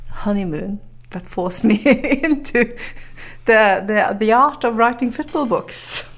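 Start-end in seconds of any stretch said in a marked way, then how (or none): 1.6-6.0 laughing. Real, not acted. Laughs recounting traumatic honeymoon.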